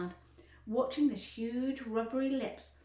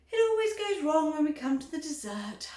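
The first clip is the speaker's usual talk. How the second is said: sighing; 6 semitones higher, 7 dB louder than usual. A narrator's reading. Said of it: "sighed Mister Wonka".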